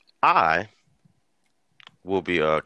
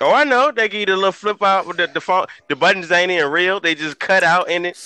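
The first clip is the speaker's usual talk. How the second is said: excited; 11 semitones higher, 6 dB louder than usual. Real, not acted. Laughing about old phones.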